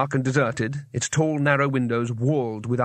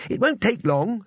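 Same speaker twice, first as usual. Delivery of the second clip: higher, untroubled insistence; firm; 8 semitones higher than usual. A narrator's reading. Two Flower overruling Rincewind, higher and firm. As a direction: brush the worry aside. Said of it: "said Two Flower firmly".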